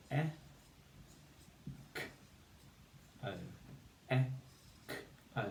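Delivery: each sound of echo said separately. Shown as a demonstration. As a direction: break echo into e, k, o. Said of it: "You need to say the sounds".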